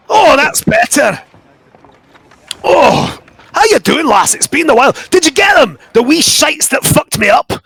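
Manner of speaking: fussy little Scot stuck in a fire; small Scottish mycon. Acted. Fergus begging the lass to lift him out of the fire, high Scottish voice.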